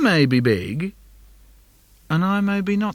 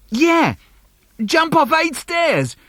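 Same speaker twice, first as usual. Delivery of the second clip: put-on much higher, slower kid squeal; excited little brother; 13 semitones higher, 4 dB louder, slower than usual. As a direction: egg him on, thrilled. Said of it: "He says".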